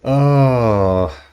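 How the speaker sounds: long loud rising oh; loud triumphant oh. Real, not acted. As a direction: call it out in triumph. Loud exclamation as enemy falls.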